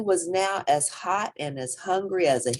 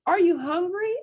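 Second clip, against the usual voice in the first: kindly; 4 dB louder than usual. A narrator's reading. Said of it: "asked his mom kindly".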